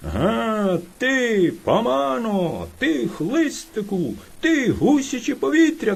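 high, furious bear yelling insults; bear shouting. Acted. Hungry bear yelling at the late hare, furious. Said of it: "кричав на нього ведмідь".

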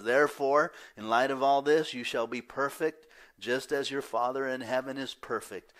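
loud, high, fervent sermon voice; full-force preaching. Acted. Pastor pressing the church to be perfect, loud and high. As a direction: thunder the command to be perfect.

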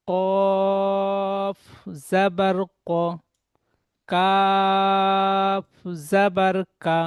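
each letter drawn out slowly and sung; slow teacher's chant of the alphabet; slow spelling drill. Shown as a demonstration. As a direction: chant the letters with their vowel, stretching every name. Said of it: "তাহলে এখানে পড়বেন".

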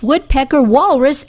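plain reading voice

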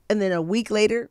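ordinary delivery

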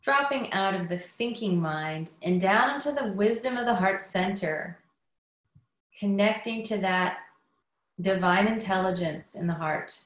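unhurried meditation guidance with long pauses; guided meditation. Acted. Inviting listeners to settle in before the talk, guided meditation. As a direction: ease them inward, leaving space after each phrase.

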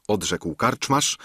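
usual speaking voice

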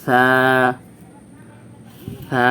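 long, thin lisped "সা"; correct Arabic pronunciation. Shown as a demonstration. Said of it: "সঠিক উচ্চারণ করতে হবে এইভাবে".

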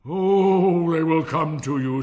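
booming, high, patronizing assurance; hearty, pompous miller. Acted. Miller assures admiring Hans, loud and patronizing. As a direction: boom it out with a long opening Oh.

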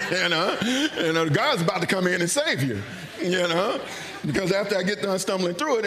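talking through laughter; amused, chuckling as he speaks; real laughter in the words; laughing. Real, not acted. Laughing while telling the story.

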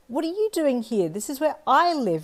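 put-on high, loud squeaky little girl's protest; squeaky child. Little girl confronts the boy in her yard, high squeak. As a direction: squeak it out indignantly like a small child. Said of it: "you squeaked".

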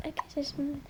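unvoiced whisper to the camera. Real, not acted. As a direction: whisper so he won't hear. Hiding from Lucas, to camera.

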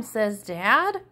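ordinary delivery

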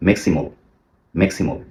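ম্যাক্সিমাল ending in a short "mol"; correct maximal. Shown as a demonstration. Teacher corrects ম্যাক্সিমাল. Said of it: "এটাও ভুল".

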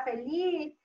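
ordinary talk, nothing marked

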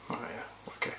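breathy unvoiced "Oh yeah" under the breath; sarcastic whisper. Real, not acted. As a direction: mutter it sarcastically to yourself. Sarcastic reply to wife's boast.

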